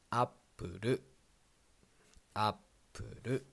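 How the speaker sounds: "Apple" as ap-pu-ru; アップル; Japanese pronunciation. Shown as a demonstration. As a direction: say "Apple" the katakana way, ending on ru. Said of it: "The next one is".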